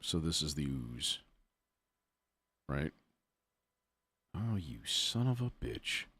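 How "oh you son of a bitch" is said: ordinary delivery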